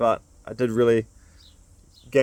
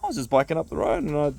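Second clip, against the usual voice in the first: a hint of Jam's voice; 7 semitones higher than usual. Jam answers, voiced.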